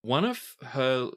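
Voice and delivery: ordinary talk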